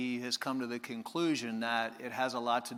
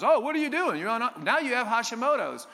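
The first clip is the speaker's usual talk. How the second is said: much higher, louder mock-shocked doctor; mock alarmed doctor; 10 semitones higher, 7 dB louder than usual. Doctor scolding a patient, higher and louder in mock alarm. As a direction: play the doctor gasping at the bad news. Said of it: "the doctor goes".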